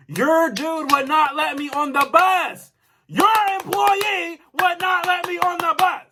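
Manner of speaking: high, slow, drawn-out shouted protest; mad outburst. Retold or imitated. Customer retelling complaint about being kept off the bus, mad. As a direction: yell each sentence as its own accusation. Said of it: "I'm just mad right now".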